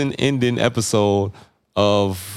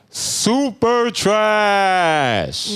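drawn-out insult; 11 semitones higher, much slower than usual. Real, not acted. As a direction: stretch out the roast for laughs. Roasting her as super trash.

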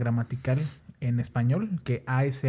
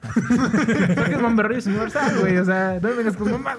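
laughing; 6 semitones higher than usual. Real, not acted. Laughing at own joke.

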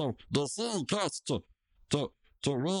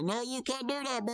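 mock Mordecai; 10 semitones higher than usual. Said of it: "Mordecai's like".

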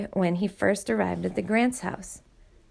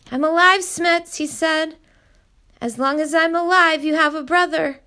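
urgent comfort; 8 semitones higher, 6 dB louder than usual. Acted. Luke comforts grieving Smits.

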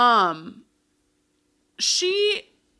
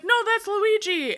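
high-pitched protest; hammed-up surprise, pitched way up; mock startled correction; 11 semitones higher than usual. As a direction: correct them in a much higher voice. Acting Harry's startled correction.

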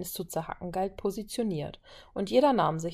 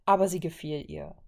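ordinary delivery

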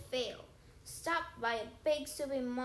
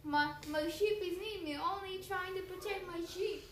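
higher, whining plea of a beaten giant; a monster begging like a child; 7 semitones higher than usual.

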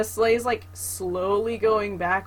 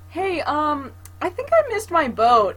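high, louder sheepish voice; sheepish Jesper; 7 semitones higher, 6 dB louder than usual. Retold or imitated. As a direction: play it innocent and sheepish. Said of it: "finally he's like".

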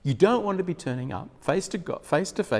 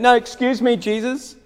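higher and louder, protesting from above; calling down from the roof; 8 semitones higher, 7 dB louder than usual. Retold or imitated. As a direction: shout down, politely frantic. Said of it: "They're calling down and saying".

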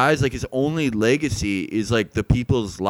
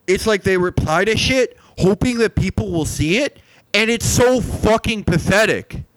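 heated rant; 7 semitones higher than usual. Acted. Ranting about social media repliers.

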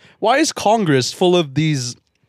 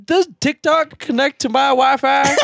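put-on slow, high doddering old man; old senator voice; 11 semitones higher, 3 dB louder, slower than usual. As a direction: play a clueless old politician. Mocking old senator question.

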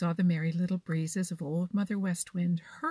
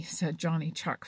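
plain reading voice

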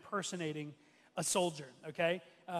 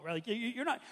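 ordinary delivery

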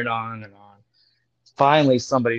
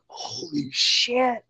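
high, drawn-out hushed amazement; awestruck; 10 semitones higher, 5 dB quieter, much slower than usual. Retold or imitated. Dealer realising who the famous boxer is, awestruck. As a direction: stretch it out in starstruck disbelief.

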